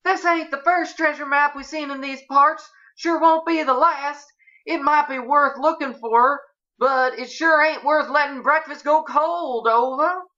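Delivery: very high, put-on folksy voice; high-voiced ranch guest. A narrator's reading. Eunice puts breakfast before treasure, in high folksy voice. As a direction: keep it high and folksy throughout.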